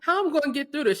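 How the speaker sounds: high, loud mock panic to a friend. Mom asking her friend for advice in a high panicked squeal. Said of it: "I really just be having to talk to your mom".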